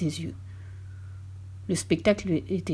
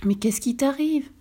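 higher-pitched, astonished question; stupefied; 6 semitones higher than usual. A narrator's reading. Old man questions his raging wife, stupefied. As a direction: ask in mild bewilderment. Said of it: "demanda le vieil homme stupéfait".

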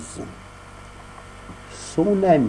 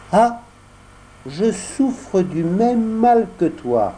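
put-on higher, slow boy voices; voix d'enfant; 10 semitones higher, slower than usual. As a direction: boys confessing slyly. Dialogue des deux amis. (voix d'enfants rusés)

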